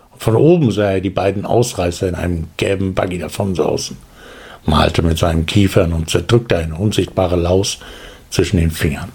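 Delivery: usual speaking voice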